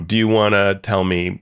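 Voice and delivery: ordinary delivery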